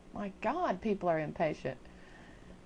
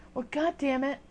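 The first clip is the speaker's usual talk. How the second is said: mock huffy anger; 7 semitones higher, 6 dB louder than usual. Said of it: "They get all mad and huffy".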